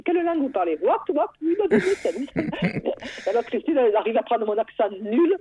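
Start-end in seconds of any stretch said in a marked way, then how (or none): 0.0-2.9 high mock foreign asking; imitating the Englishman. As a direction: mimic a puzzled English speaker, pitched way up. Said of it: "l'anglais nous disait". (imitation d'un Anglais, voix aiguë)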